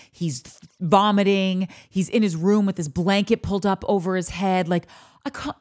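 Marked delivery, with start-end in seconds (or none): 5.2-5.6 put-on a half-hearted go at a British accent; attempted British accent. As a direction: start in British, softly attempted. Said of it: "I can't do a British accent".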